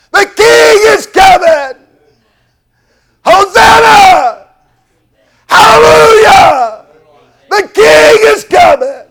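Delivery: high, loud, drawn-out shouts; jubilant shouting. Retold or imitated. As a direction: hail the coming king. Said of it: "Somebody shouted out".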